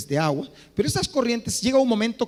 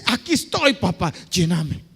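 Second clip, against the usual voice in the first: tender prayer; 4 semitones lower than usual. Pretend. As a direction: pray it gently, like a child to a father.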